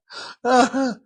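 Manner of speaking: high chuckle; amused laugh. Real, not acted. Preacher tickled by own joke about children, laughing.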